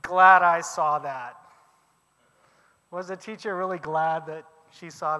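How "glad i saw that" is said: slow, louder deadpan; a teacher's slow sarcastic jab at a spilled bucket; dry sarcasm. Retold or imitated. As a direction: say it dryly, meaning the opposite. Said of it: "the teacher looks over and says".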